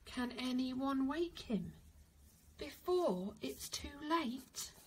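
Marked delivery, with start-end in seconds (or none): none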